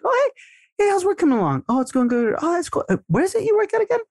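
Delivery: way up high, airy and fast, all innocence; playing it casual. Shown as a demonstration. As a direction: act like it just crossed your mind. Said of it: "you're playing it so casual that you're like".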